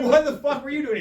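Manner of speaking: high, loud incredulous question; mock exasperation. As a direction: demand a reason in comic frustration.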